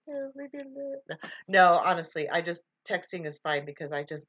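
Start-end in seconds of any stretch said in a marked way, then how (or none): 0.1-1.2 high, soft, drawn-out brush-off; mock reluctance, softly sing-song; reluctant. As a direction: put on a slow, high reluctant voice. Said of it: "I'm just like".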